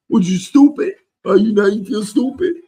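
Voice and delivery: loud, high-pitched mock jeer; taunting. Fan taunting doubters of his band, mocking.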